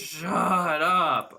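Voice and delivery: loud, drawn-out playful yell; mock yelling. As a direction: yell it at absent pests in jest. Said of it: "I'm yelling at the Apple people".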